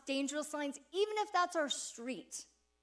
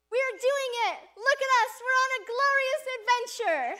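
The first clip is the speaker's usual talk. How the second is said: high, loud, excited rallying cry; cheering her on; 10 semitones higher, 8 dB louder than usual. Retold or imitated. Pastor cheering on a tired traveller mid-run, loud and excited. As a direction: pump her up like you're on a quest together. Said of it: "This is what I said".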